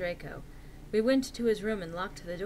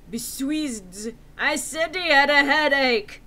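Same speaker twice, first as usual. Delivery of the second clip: indignant whine; 3 semitones higher, 6 dB louder, slower than usual. Acted. Indignant author's note read aloud.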